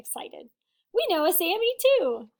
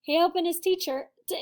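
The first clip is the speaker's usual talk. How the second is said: usual speaking voice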